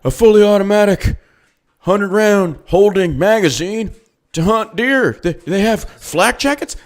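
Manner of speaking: hammed-up ignorant bureaucrat; mocking politician. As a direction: make him sound clueless. Mocking gun-control politician.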